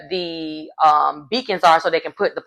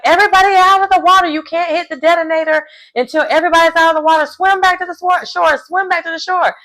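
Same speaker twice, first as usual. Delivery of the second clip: imitated yell; 10 semitones higher, faster than usual. Host Claudia orders swimmers back to shore, imitated urgent yell. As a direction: hurry everyone out of the water. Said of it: "And Claudia's like".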